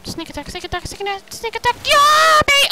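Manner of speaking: quick chant climbing to a loud high yell; building excitement. Real, not acted. Gamer springing a sneak attack, building to triumphant yell.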